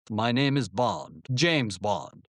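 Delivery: low, slow, lightly put-on British Bond voice; a hint of a bad Sean Connery British accent; awful British accent. Boy doing his bad British Bond for classmates. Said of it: "my mimicking in what must have been an awful British accent".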